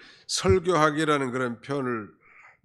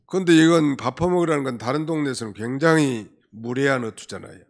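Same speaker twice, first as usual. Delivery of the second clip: normal talking voice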